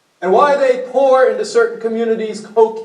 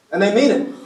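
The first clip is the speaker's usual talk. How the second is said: ordinary talk, nothing marked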